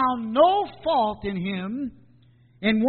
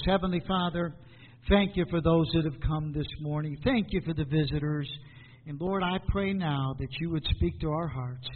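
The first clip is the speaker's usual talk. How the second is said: lower, softer praying voice; prayer; 5 semitones lower, 4 dB quieter than usual. Real, not acted. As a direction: pray quietly and earnestly to God. Leads the congregation in prayer.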